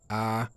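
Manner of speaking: A held straight and level; long, steady first-tone A; first tone, direct. Shown as a demonstration. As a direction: say A directly, without bending the pitch. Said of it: "on le prononce direct".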